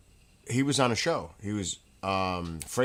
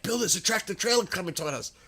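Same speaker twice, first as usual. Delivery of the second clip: alarmed friend; 10 semitones higher, faster than usual. Retold or imitated. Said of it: "And Petita's like".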